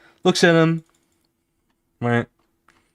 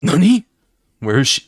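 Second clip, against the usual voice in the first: higher, louder startled cry that settles down; sudden shock, then looking around; startled goon; 4 semitones higher, 6 dB louder than usual. Acted. Goon looks for vanished girl.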